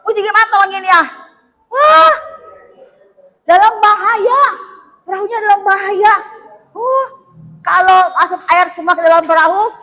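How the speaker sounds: normal talking voice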